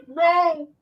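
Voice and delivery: high squeal of disbelief. Real, not acted. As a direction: shriek it in delighted shock. Laughing disbelief at judge.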